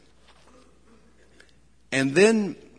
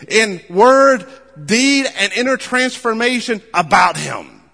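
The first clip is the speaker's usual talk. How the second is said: loud, rising preacher's call, pushing each line; preaching at full force; 6 semitones higher, 9 dB louder than usual. Acted. Pastor rallying the congregation, preaching at full force. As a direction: drive the mission home like a revival preacher. Sermon builds to a climax.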